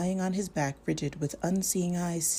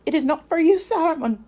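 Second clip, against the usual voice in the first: much higher and louder, flat and shrill; shrill, toneless cry; 12 semitones higher, 10 dB louder than usual. Acted. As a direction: cry it out like a possessed voice, no feeling in it. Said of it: "he cried in a shrill and toneless voice".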